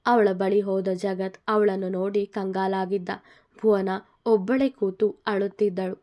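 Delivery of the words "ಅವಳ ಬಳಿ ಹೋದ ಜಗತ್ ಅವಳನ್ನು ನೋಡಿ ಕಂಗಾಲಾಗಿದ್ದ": usual speaking voice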